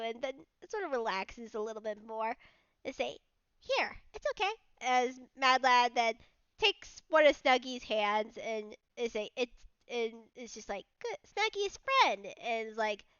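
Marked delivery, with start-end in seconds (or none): 3.6-4.5 higher, gentle coaxing; reassuring. Acted. Mad Lad coaxing the bug, higher and gentle. 11.0-12.3 higher, slow praise; praising bug. Acted. Mad Lad praising the bug, higher and slow.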